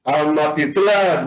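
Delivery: Amatitlán slowed, weight on "tlán"; stress on final syllable. Shown as a demonstration. Teacher repeats the example before quizzing, Amatitlán stressed on "tlán". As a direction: lean on the last syllable of Amatitlán. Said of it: "¿dónde está la sílaba tónica?".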